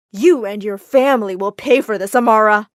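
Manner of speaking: loud, menacing warning; angry threat. Acted. Spurned fiancé threatening Amara and family, loud and angry.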